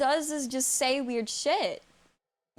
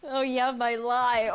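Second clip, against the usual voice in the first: ordinary talk, nothing marked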